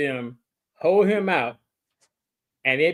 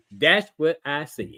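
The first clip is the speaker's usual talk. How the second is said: slow, each word separate; slower, deliberate; 3 semitones lower than usual. Real, not acted. Finishing the re-explanation to his cohost, slow and deliberate. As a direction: close the point word by word. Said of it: "let me say it a little bit slower".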